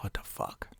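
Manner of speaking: breathy unvoiced what the fuck; whispered disbelief. Hearing what his daughter did, whispered in disbelief. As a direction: whisper it in shock.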